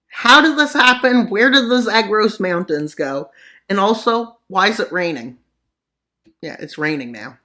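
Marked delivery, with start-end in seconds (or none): 0.1-5.4 confused leader of Iran. Pretend. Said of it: "the leader of Iran is like".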